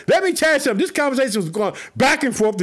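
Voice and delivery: ordinary talk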